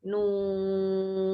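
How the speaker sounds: Nun held long for six beats. Shown as a demonstration. Teacher modelling Quran letter to students, long drawn nun. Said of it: "Huruf nun dibaca enam harokat".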